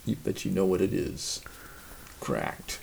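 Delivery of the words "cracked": a soft breathy whisper of the word Cracked; whispered aside. Real, not acted. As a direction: breathe Cracked almost without voice.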